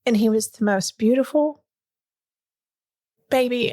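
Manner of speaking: real emotion lifting the voice, halting before the last word; moved, struggling to get through it; emotional. Real, not acted. Mother remembering first seeing her newborn, emotional. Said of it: "I still get emotional about it".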